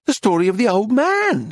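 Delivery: high-pitched, exasperated anger; furious. A narrator's reading. Dealer demands the old man's story, furious. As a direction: insist angrily, pitch climbing. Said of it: "she said furiously".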